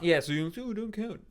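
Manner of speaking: lightly put-on Joel voice; doing Joel. Teasing his co-host by doing Joel's voice. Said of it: "No, I was doing a Joel".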